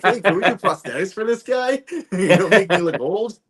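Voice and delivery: high, loud, laughing through the quote. Retold or imitated. Actor retelling the crew's prosthetics question, laughing high. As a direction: giggle out the casting team's question. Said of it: "They're like".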